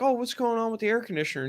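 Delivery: high-pitched, quick mock wife voice; imitated wife. Wife to husband about the air conditioner, imitated high voice. Voicing wife arriving home.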